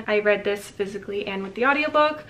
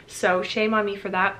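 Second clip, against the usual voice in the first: normal talking voice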